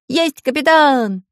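high, loud, slow eager reply; joyful crew answer; glad shout. Acted. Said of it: "радостно закричали". (радостный громкий ответ)

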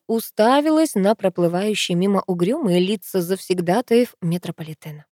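ordinary delivery

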